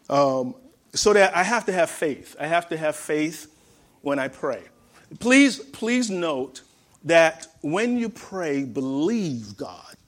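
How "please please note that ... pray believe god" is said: 1 slow emphasis. Real, not acted. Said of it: "slow emphatic exhortation".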